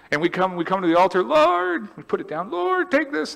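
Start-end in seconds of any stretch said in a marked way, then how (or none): 1.2-1.9 pleading prayer. Retold or imitated. Believer crying out at altar. 2.5-3.4 pleading prayer. Retold or imitated. Believer crying out at altar.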